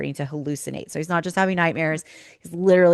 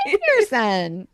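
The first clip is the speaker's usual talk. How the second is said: mock son; 3 dB louder, much slower than usual. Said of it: "He's gonna be like".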